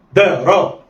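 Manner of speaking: "دا را" run together in one quick go; snatch "دا را" with no pause between. Shown as a demonstration. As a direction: blend "دا را" like one short word. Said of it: "دي طريقة الخطف".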